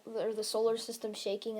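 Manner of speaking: ordinary delivery, nothing marked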